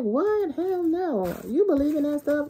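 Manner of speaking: high, loud startled disbelief; a friend brushing off hex talk in shock. Acted. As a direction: scoff like it's the wildest thing you've heard. Friend says someone's hexing you.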